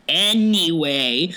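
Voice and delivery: very slowly drawn-out "anyway"; pointed anyway. Retold or imitated. Friend brushing off the bartender who walks away, pointedly dismissive. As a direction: stretch it out to dismiss her.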